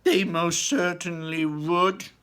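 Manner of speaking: high, loud slow protest; high loud slow indignant father; indignant cry. Acted. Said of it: "cried Mister Gloop indignantly".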